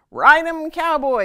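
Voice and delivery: loud, high cheering yell. Acted. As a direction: whoop it at the galloping Papa. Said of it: "yelled Brother and Sister".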